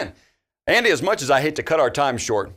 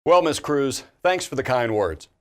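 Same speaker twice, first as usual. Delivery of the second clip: usual speaking voice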